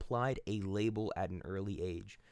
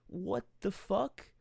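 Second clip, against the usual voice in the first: much higher, slow, stretched disbelief; can't believe what people became; incredulous; 10 semitones higher, slower than usual. Real, not acted. Reacting to the abusive guards.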